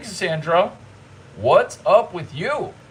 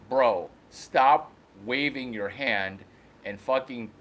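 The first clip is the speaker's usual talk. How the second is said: voicing the cow; 3 semitones lower, 8 dB quieter than usual. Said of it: "He's like".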